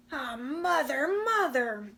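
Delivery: croon-growl into her ear; growling wolf. Acted. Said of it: "the stranger growled in one ear".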